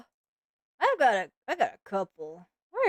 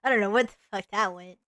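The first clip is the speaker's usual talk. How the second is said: ordinary talk, nothing marked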